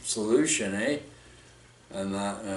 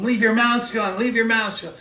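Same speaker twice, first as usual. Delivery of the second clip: urgent raised call, higher in pitch; urgent warning; 9 semitones higher than usual. Retold or imitated. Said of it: "I told them".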